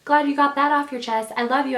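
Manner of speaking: high-pitched, lightly acted acceptance; unbothered reply. Vlogger models answering a hater as punching bag, unbothered. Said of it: "be the punching bag and be like".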